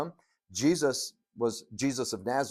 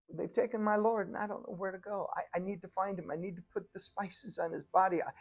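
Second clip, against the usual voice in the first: higher, urgent pleading; acted distraught Mary; 6 semitones higher than usual. Crying Mary pleads for her Lord's body, mock distraught. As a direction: act out frantic pleading, pitched up. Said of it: "she's crying".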